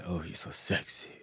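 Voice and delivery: hushed, low mock seduction; whispered sexy talk. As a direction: mimic bedroom talk in a whisper. Mimics partner's supposed bedroom talk.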